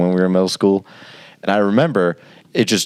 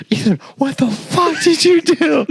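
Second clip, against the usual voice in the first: high-pitched mock outrage of a mother; furious mom, voice pitched way up; angry mom. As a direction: imitate mom freaking out on the phone. Retold angry phone call from mom.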